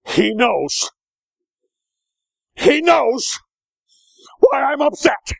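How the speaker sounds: high, loud mock-wife voice; imitated upset wife. Preacher voicing what the ladies are thinking, mock upset. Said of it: "You're thinking something like this".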